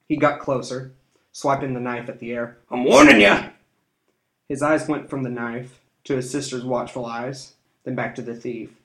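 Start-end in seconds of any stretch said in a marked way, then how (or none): 2.7-3.5 scared threatening yell. A narrator's reading. Thief swipes knife, threatens.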